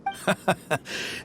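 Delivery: a short, airy real chuckle; amused chuckle. Real, not acted. Elder chuckles at Cyno not knowing, amused. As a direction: laugh softly at Cyno's surprise.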